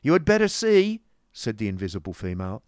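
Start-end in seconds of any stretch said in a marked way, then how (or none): 0.0-1.0 high, loud call from upstairs; calling woman's voice. A narrator's reading. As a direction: call out as the landlady. Invisible female calls down.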